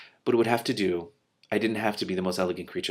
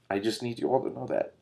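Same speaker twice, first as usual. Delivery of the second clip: usual speaking voice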